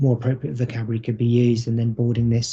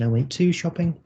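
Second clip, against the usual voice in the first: higher-pitched echo with "to" stressed; upwards intonation; 5 semitones higher than usual. Shown as a demonstration. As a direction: query the word "to", pitched up. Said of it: "with an upwards intonation".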